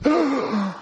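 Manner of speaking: mock shocked gasp; imitated congregation gasp. Preacher imitating his shocked church, mock gasp. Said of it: "should have heard the gasps".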